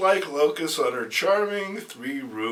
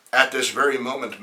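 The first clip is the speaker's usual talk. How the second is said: ordinary delivery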